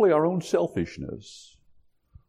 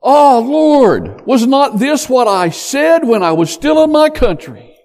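high, loud exasperated protest to God; loud, high indignant prayer; frustrated complaint; 9 semitones higher, 5 dB louder than usual. Acted. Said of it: "a high level of frustration with God".

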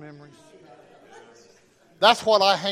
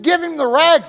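insistent order; 10 semitones higher, 11 dB louder than usual. Retold or imitated. Urging nervous coworker.

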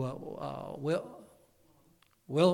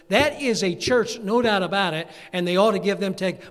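preaching fervor; 3 semitones higher, 9 dB louder than usual. Real, not acted. Preacher railing at Romania's government, loud and indignant. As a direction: thunder it at the government.

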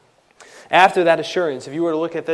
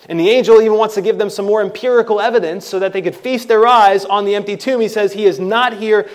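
impassioned preaching; 7 semitones higher, 12 dB louder than usual. Acted. Preacher proclaiming the risen Christ to the congregation, loud and high. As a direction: proclaim it with fervor.

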